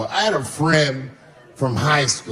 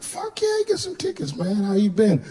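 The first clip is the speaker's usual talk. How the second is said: high, eager and quiet reply; excited; 6 semitones higher, 4 dB quieter than usual. Retold or imitated. To old friend on the phone, high eager reply. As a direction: jump at the offer, voice way up.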